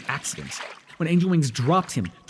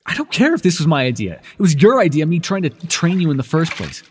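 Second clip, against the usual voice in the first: louder, heated rebuke; 3 semitones higher, 7 dB louder than usual. Acted. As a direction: scold him, voice raised. Argument with trainee.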